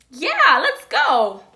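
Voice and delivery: loud, drawn-out, high cheer; eager acceptance. Retold or imitated. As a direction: imitate someone whooping a very keen yes. [name] eagerly accepts ice cream, retold.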